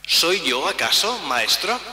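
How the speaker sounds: ordinary talk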